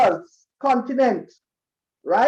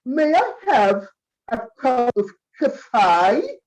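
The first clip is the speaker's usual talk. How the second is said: put-on slow, prim English-accented request; slower than usual. Englishman asking the servant for coffee, in an English accent. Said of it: "say like".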